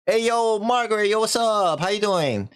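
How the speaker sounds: high-pitched, over-friendly hey; mock eager greeting. Man greets Margaret to woo her.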